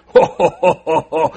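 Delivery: loud hearty ho ho laugh; belly-laugh like a teasing giant; mock god laugh. God laughs at the Israelites, loud teasing ho ho.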